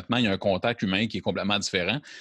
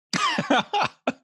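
high laugh. Real, not acted. Laugh after host's joke.

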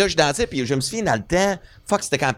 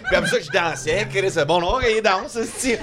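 usual speaking voice

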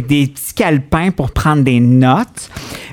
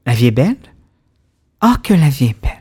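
sultry narrator; faster than usual. Shown as a demonstration. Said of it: "je vais prendre ma voix suave".

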